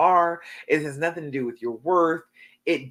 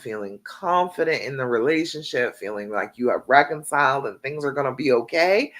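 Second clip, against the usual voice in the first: normal talking voice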